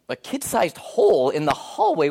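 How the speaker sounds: slow, incredulous repeat of the news; disbelieving echo. Retold or imitated. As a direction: echo it back as if it can't be true. Pastor echoes in disbelief.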